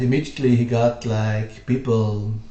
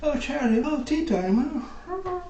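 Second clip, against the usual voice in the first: very high, airy teatime voice; mock posh Englishman; 5 dB quieter than usual. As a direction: ham up a prissy English gent. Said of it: "And I was like".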